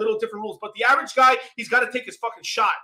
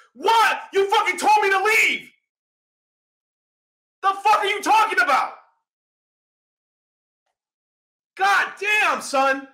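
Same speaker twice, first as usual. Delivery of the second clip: much higher, loud incredulous shouting; furious outburst; 9 semitones higher, 5 dB louder, slower than usual. Real, not acted. Shouting at people who told him to leave, furious. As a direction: explode in disbelief and rage. Exploding at contradictory advice.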